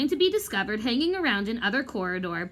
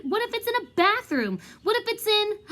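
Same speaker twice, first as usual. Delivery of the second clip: pitched up, rushing toward the idea; an idea catching fire; excited, higher; 11 semitones higher than usual. Acted. Ron's rising excited guess.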